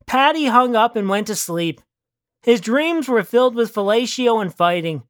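plain reading voice

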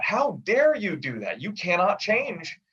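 a hammed-up scandalized how dare you; indignant rebuke. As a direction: church elders scolding the copyists, mock outrage. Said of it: "these guys were rebuked".

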